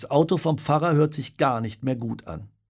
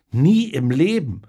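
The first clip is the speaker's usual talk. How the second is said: louder, slow, emphatic outrage; appalled refusal; 4 semitones higher, 6 dB louder than usual. A narrator's reading. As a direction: refuse in horror, stretching the words. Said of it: "Entsetzt guckt Annette".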